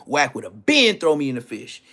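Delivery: ordinary talk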